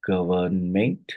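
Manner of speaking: "government" slowly in three separate syllables. Shown as a demonstration. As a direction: spell out the three beats of "government". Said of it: "So it's a tri".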